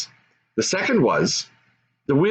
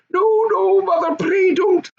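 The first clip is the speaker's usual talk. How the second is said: pleading cry. Acted. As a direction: cry out to stop the blow. Goblin child begs not to be hit.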